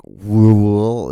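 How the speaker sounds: put-on loud, woozy drunken woo; slurred drunk whooping; belligerent drunk.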